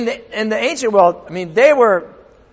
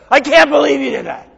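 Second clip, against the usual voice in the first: high-pitched mock scolding; retold angry outburst; 7 semitones higher, faster than usual. Father showing what he never said to his son, mock angry outburst. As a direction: replay an exasperated parent's outburst. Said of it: "have I ever been angry at my son?".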